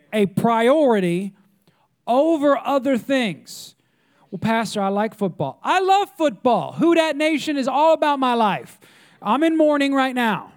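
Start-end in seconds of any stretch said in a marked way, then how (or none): 5.6-10.5 higher-pitched, hammed-up fan fervor; mock fan protest. Football fan objecting to the pastor, hammed-up fervor.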